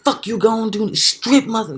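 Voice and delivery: high, loud hyped-up mock yell; loud, high-pitched aggressive taunting; imitated yelling. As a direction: yell it like a worked-up aggressor making threats. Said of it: "this fool's yelling like".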